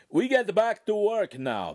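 put-on the master calling the boys back to work, loudly. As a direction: call them back to work. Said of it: "the master called".

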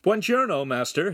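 high, quick-off-the-mark smiling hello; put-on cheerful greeting. A narrator's reading. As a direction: greet the master through a put-on smile. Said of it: "putting on a bright smile".

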